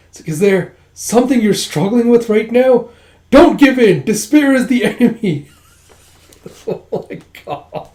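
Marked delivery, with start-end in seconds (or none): 0.3-5.5 high, loud over-the-top encouragement; rally a friend with mock fervor; rousing pep talk. [name] urging Sinha not to give in, rousing pep talk.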